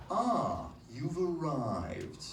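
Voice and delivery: slow, drawn-out posh English; posh English accent. Shown as a demonstration. Comic modelling the phrase for the audience, in slow posh English. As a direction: linger on it in open-mouthed posh English. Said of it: "Here's the phrase we're all gonna say".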